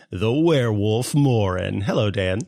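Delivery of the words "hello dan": normal talking voice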